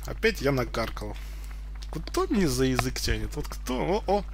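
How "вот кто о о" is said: ordinary talk, nothing marked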